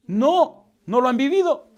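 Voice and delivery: high, loud emphatic denial; emphatic shout. Real, not acted. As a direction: shout the answer to your own question. Preacher emphatically answers own question.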